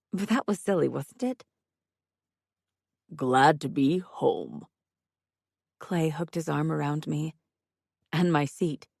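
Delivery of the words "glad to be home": very slow, drawn-out put-on voice; slow theatrical contentment; contented character voice. A narrator's reading. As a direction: savour each word, unhurried.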